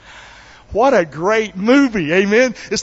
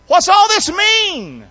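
high, drawn-out shouted question; loud, high-pitched demand for an explanation; calling out to a servant; 13 semitones higher, 5 dB louder, slower than usual. Acted. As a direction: holler it out, stretching the words. Elder son calling to servant.

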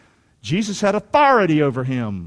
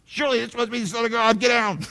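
hurried, higher-pitched comic alarm; mock panicked awe; 4 semitones higher than usual. Preacher acts out hitting the deck, hasty mock alarm. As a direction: blurt it fast as if diving for cover.

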